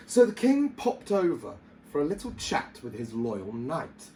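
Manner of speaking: plain reading voice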